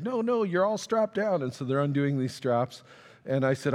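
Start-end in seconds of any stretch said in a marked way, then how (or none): 0.0-1.4 high-pitched hurried protest; raised-pitch retold plea to hold still; imitated urgent warning. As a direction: stop someone in mock alarm. Acts worker stopping his escape.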